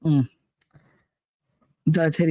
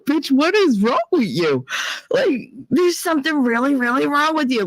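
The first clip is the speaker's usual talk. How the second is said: high-pitched, bitchy self-scolding; mock-aggressive, incredulous telling-off; over-the-top bitchy; 9 semitones higher than usual. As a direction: chew yourself out with exaggerated aggression. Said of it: "with the aggressiveness and the bitchiness".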